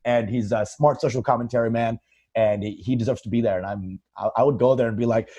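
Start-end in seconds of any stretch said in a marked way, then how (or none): none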